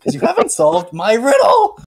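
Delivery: high, put-on riddle-keeper voice. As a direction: pitch it up like an odd little gatekeeper. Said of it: "he's, like, a higher pitched voice".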